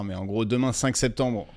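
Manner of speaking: ordinary delivery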